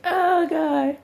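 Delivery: high, loud cry of helpless laughter; real laughing. Real, not acted. Vlogger cracks up after mocking Van, laughing. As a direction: wail it while cracking up.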